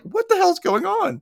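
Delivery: high-pitched mock exasperation; exasperated outburst. Graham is ignored by the visitor, high exasperated outburst. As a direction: act bewildered and fed up, voice shooting up.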